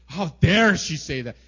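loud mock outrage; hammed-up offended outburst; worsening anger. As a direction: burst out in acted indignation. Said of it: "the anger actually gets worse and worse".

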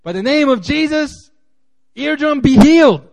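imitating a churchgoer loudly commanding an eardrum to heal; hammed-up healing preacher. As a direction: boom it out like a showy faith healer. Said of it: "he'd be like".

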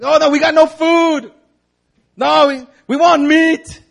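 high, loud whining crowd voice; whiny Israelites. Retold or imitated. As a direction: whine for food like spoiled kids. Mocking complaining Israelites.

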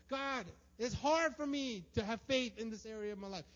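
loud, high, pleading confession to God; desperate prayer. Pretend. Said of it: "bringing it to the light and saying".

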